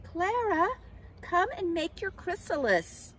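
high, loud friendly summons; calling out. A narrator's reading. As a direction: call across to a friend, pitched well up. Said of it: "one day Cornelius called".

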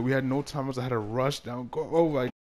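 ordinary talk, nothing marked

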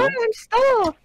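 very high squeaky star voice; sparkly tinkling star voice. Pretend. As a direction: chirp it like a tiny star. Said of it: "Tom, please do the Star voice".

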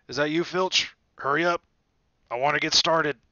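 loud, distant booming call; Hagrid calling out. A narrator's reading. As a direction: boom it across the dark grounds.

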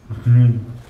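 short real laugh; a burst of laughter; hearty laugh. Real, not acted. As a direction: laugh out loud at the joke. Laugh after duck dog.

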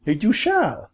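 high-pitched bird declaration; lightly voiced bird, high and decided; declaring. A narrator's reading. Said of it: "declared Bob".

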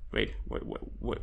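soft unvoiced what; whispered confusion. Real, not acted. Gamer reading a note, whispered confusion. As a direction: whisper it puzzled.

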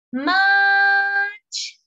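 "Match" sung out high and loud; loud high call. Shown as a demonstration. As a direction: call the word out across the room. ("Match" exclamado agudo y fuerte)

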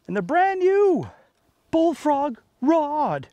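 high, loud and drawn out slowly, savouring each word; sales emphasis. Real, not acted. Angler showing off the new rod to viewers, emphatic and slow. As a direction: sell the rod like a proud pitchman.

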